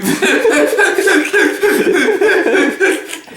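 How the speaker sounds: long high-pitched laugh; real, drawn-out giggling fit. Real, not acted. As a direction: crack up helplessly at your own bit. Cracks up at own impression.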